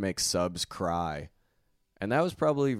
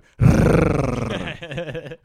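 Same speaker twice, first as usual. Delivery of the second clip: loud laugh; 7 semitones higher, 8 dB louder than usual. Real, not acted.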